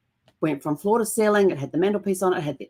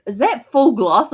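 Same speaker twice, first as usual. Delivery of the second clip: incredulous question; 6 semitones higher, 6 dB louder, slower than usual. Retold or imitated. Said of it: "he looks at it and he goes".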